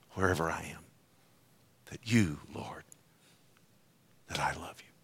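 low, fading to a near whisper; hushed prayer. Acted. Preacher prays his love to the Lord, hushed. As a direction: let it sink almost to nothing.

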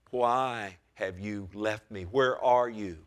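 usual speaking voice

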